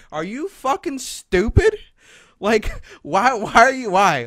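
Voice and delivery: loud, high, incredulous retold rebuke; exasperated scolding. Specialist scolding student in his office, exasperated. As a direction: imitate a fed-up authority, slowing on the first question.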